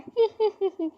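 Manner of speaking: high mocking titter; mocking laugh. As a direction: titter at her expense. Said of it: "उस पर हंसती है".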